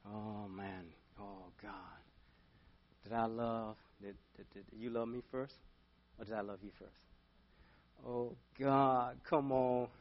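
very soft, low grumbling plea; acted reluctant giving in. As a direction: plead with God under the breath, grudgingly. Acted reluctant pleading with God.

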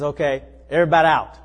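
loud, firm "Everybody out"; a fed-up landlord's order; pastor ordering out. Retold or imitated. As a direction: clear the room with authority.

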